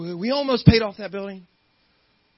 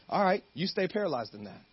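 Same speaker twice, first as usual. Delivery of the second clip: ordinary delivery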